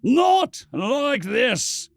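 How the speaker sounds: slow, high, loud anguished scream. Acted. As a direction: scream it out in despair. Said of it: "he screamed out".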